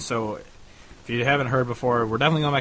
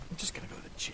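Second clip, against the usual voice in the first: whispered aside; 16 dB quieter, much faster than usual. Retold or imitated. Quoting herself, house dark.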